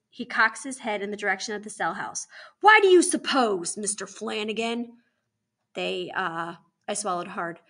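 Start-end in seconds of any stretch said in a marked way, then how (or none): 2.6-5.0 loud, pointed challenge; single out a child for an answer; stern questioning. Acted. Warden interrogates Moose.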